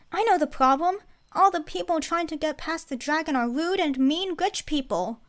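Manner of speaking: put-on high, loud know-it-all kid; eager child voice. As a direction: announce it like a proud child.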